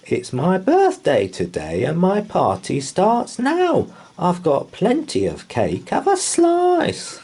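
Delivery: a mummy inviting everyone to cake; friendly mummy voice. A narrator's reading. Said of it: "said the mummy".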